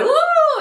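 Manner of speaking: put-on high, loud playful ooh for the baby; mock ooh. Describing the baby kicking on the scan, a high squealed ooh. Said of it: "She was just like".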